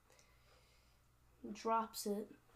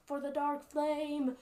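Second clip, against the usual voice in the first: slightly raised, louder, slow rallying call; mild war cry; 4 semitones higher, 5 dB louder, slower than usual. Acted. Orc leader's cry translated for the listener, mild war cry. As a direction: stretch it out like a restrained battle cry.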